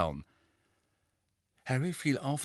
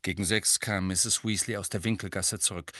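usual speaking voice

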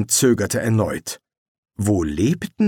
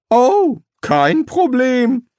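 high, slow sarcastic drawl; mock the demand with a slow "no problem"; 6 dB louder, much slower than usual. Rincewind mocks the summoner's wishes, sarcastic. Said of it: "kommentierte Rincewind sarkastisch".

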